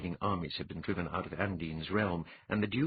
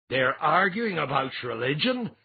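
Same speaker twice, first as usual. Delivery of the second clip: louder, disbelieving demand; incredulous; 8 semitones higher, 6 dB louder than usual. A narrator's reading. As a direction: demand it, amazed. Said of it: "Twengor demanded incredulously".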